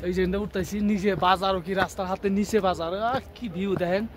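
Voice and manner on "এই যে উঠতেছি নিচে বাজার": ordinary talk, nothing marked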